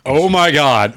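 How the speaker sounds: in-character stunned exclamation; dawning shock. Acted. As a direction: let the realization hit you. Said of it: "Finally realizing what's happened around me".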